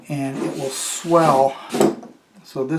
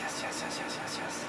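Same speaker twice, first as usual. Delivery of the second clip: whispered string of yeses, softer; 12 dB quieter than usual. Real, not acted. Fixer whispering to himself as the part frees, gleeful. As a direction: hiss a rapid run of quiet yeses. Quiet triumph after success.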